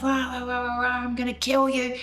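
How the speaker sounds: mock raging gibberish into a threat; going off. Husband threatening a complete stranger, ranting in imitation. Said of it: "he's going off".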